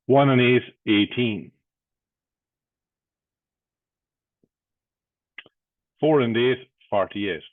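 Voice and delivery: loud punchy bingo call, number then total; bingo caller. Acted.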